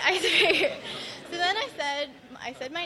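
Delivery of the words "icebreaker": laughing repeat. Real, not acted.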